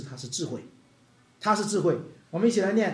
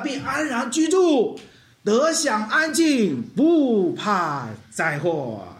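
scripture reading; 5 semitones higher, slower than usual. Acted. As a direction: proclaim each line slowly. Reads scripture aloud. (缓慢有力地宣读经文)

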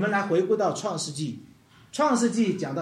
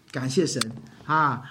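ordinary talk, nothing marked

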